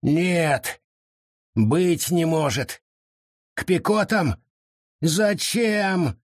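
high, rising dismayed cries; shocked disbelief. Acted. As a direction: recoil at the awful news. Forest shocked by terrible news.

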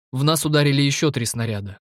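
ordinary delivery

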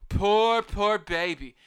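put-on a sarcastic, babyish poor-baby; mock pity. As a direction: sneer at her self-pity. Mocking her self-pity.

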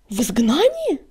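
aghast question, swooping upward; appalled disbelief; horrified. A narrator's reading. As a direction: ask it in dread. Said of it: "В голосе его прозвучал неподдельный ужас".

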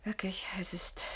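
tonloses Flüstern, damit der Lauscher nichts hört; geflüstert. Acted. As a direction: ganz leise hauchen, fast ohne Stimme. Flüstern, Mann lauscht hinter Tür. (flüstert dem Freund zu, weil jemand hinter der Tür lauscht)

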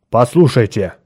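loud, forceful call for attention; firm aggressive opening. A narrator's reading. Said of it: "сказал он агрессивным тоном".

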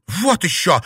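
high, loud, indignant retort; scornful young rebel; defiant refusal. Acted.

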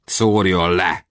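slow, sullen reply; dark resignation. A narrator's reading. Beaten captive answering his captor, slow and grim. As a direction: say it slowly and grimly. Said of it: "felelte sötéten".

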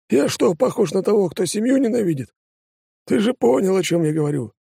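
lightly voiced offended deputy; indignant deputy voice. Депутат возмущённо оправдывается. (обиженный депутат)

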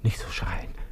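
whispered self-rebuke. Acted. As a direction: hush yourself so nobody hears. Hushing himself in café.

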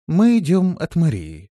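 calm dignity. A narrator's reading. Листи telling the villager who sent them, dignified. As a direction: state it with dignity. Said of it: "спокойно, но с достоинством".

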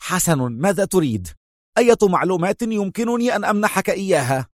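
nervous impatience, speeding up; edgy, irritable. A narrator's reading. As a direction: pitch climbing in agitation. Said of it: "مستطردا في عصبية".

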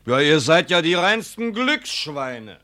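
mock their luck; sarcastic sneer. Son-in-law sneering at the poor family's gifts, sarcastic.

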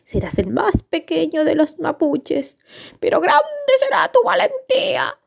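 high-pitched prophetic seer voice; seer's prophecy. A narrator's reading. Machi foretells the tiny boy's future, high prophetic voice. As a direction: intone it high, like a fortune-telling Machi. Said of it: "La Machi, que podía adivinar el futuro, le dijo".

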